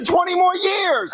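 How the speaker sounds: high, drawn-out wail; outraged disbelief. Acted.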